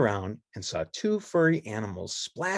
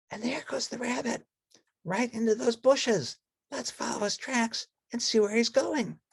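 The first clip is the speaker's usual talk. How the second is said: Betty Lou voice; 14 semitones higher than usual. Acted. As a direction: lightly point it out as Betty Lou.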